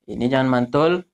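normal talking voice

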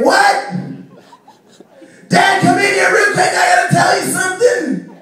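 put-on high, loud monotone kid yell; screaming kid. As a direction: bellow it from the next room like a small boy. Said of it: "Quit fucking screaming".

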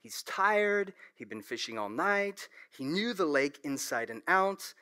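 normal talking voice

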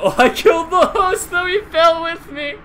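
high, loud laughing yell; excited shout. Real, not acted. Player yelling after killing the host. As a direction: crow in triumph. Kills host while dying.

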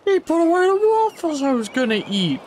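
high-pitched mock indignant whine; mock outrage. Player voicing a character angry about waffles, mock outrage. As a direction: complain in a high, put-on voice.

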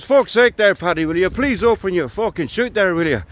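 exasperated, high-pitched Irish pleading; terrible Irish accent. Acted. Murphy begging Paddy at five hundred foot, exasperated mock Irish accent. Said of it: "apologies for the terrible accent".

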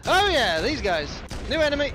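high, loud alert; excited. Real, not acted. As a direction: call out the new threat. New enemies appear in game.

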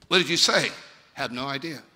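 usual speaking voice

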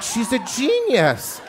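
slow, high, amazed praise; teacher amazed by the shy girl. Retold or imitated. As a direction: marvel at her, drawn out. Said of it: "And I'm like".

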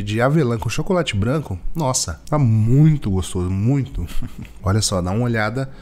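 eating while speaking; talking while chewing. Real, not acted. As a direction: mouth partly full of cake. Seen 3: Holds panettone slice, bites at 2.1, mouth working while speaking.

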